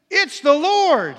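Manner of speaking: loud, slow, astonished cry; awed exclamation. Acted. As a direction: cry out in wonder at the recognition. Peter recognises Jesus on the beach.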